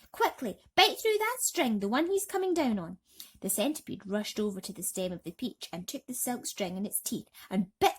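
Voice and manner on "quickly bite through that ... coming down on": high urgent yelled command. Acted. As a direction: yell it fast at the centipede. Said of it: "yelled James".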